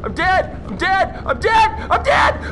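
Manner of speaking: building to a high panicked yell; mounting panic. Real, not acted. Player about to die, mounting panic.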